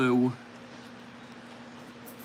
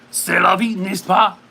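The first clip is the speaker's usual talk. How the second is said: put-on louder French accent; 3 semitones higher, 5 dB louder than usual. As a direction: say it again in French accent, with more push. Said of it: "the French accent".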